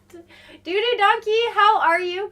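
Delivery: high, loud happy hello to a viewer; excited greeting. Real, not acted.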